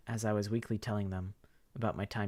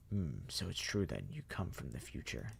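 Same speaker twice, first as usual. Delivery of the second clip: low, soft, courtly voice; polite zombie mastermind; 6 semitones lower, 6 dB quieter than usual. Acted. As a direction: menace under good manners. The Professor speaks.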